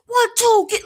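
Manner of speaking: imitating a fired-up boxer cheering, high and loud; passionate ringside yell. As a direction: belt it out like you're urging on your fighter. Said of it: "so passionate".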